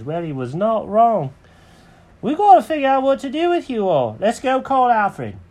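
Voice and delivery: high-pitched cartoon cat, climbing as it goes; take-charge cat in a high voice; Jenkins the cat. Cat makes a plan for the birds, high voice. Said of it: "Jenkins goes".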